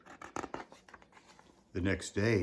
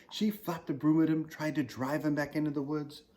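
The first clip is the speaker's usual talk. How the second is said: ordinary delivery, nothing marked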